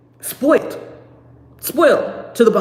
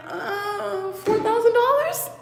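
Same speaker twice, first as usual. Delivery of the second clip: timid ask; 11 semitones higher, slower than usual. Retold or imitated. Timid money request.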